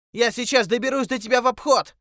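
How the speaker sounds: high, strained shout. A narrator's reading. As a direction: call out across the water. Calling across the river. (крик через реку)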